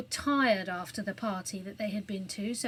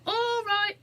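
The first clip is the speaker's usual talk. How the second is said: higher, louder call back; burglar calling back; 11 semitones higher, 5 dB louder than usual. A narrator's reading. Burglar calling back to the guard, hurried reply. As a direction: answer the guard quickly. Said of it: "replied the burglar".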